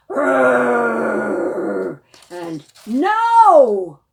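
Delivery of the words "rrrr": loud growling roar; polar bear growl. Pretend. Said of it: "He goes".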